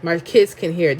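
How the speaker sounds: ordinary talk, nothing marked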